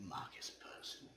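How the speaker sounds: ordinary talk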